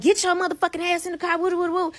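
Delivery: high, fast mock yelling; imitated rant without full volume; retold screaming. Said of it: "I was screaming at Greg".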